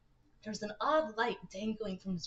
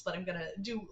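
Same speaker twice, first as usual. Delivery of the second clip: ordinary talk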